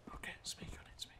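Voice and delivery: hushed whisper, no voice at all. Real, not acted. Gamer whispering to viewers to sneak past enemies. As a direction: whisper so the enemies won't hear.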